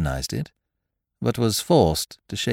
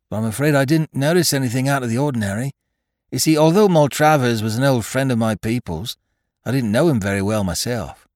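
higher, brisk English officer voice; young soldier; 6 semitones higher, 5 dB louder than usual. Acted. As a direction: chat obligingly as a young captain.

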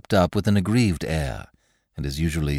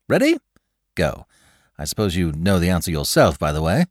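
high, louder playful game-host voice; playful Lord Peter; 6 semitones higher, 3 dB louder than usual. Acted. Lord Peter quizzing the butler Graves, playful and high. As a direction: run the game like a showman.

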